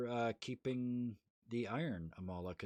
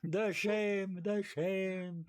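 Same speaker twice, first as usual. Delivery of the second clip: high, loud mock lament; mock shame; 10 semitones higher, 4 dB louder, much slower than usual. Player bemoaning the wrong accent to friends, mock shame. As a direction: wail in hammed-up humiliation.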